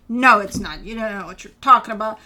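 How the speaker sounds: loud, punchy acted objection; mock indignant retort. Reader voices client disputing that it's a choice, mock indignation. Voicing the querent's angry objection.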